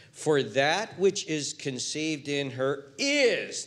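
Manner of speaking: slow, with a drawn-out "is" at the end; stress on IS. Shown as a demonstration. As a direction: lean hard on the final "is" and hold it. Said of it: "now there's the emphasis".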